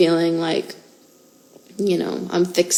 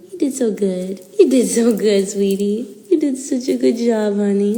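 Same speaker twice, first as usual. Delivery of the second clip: tender praise; 2 semitones higher than usual. Real, not acted. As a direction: gently praise the puppy.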